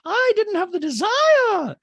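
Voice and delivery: very high, loud, stretched-out mock drama; hammed-up shouted impersonation; Dorit. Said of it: "And Dorit goes".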